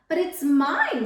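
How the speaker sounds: loud, high mock scream of the lazy bird. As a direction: shriek to claim the egg back. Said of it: "screamed the bird".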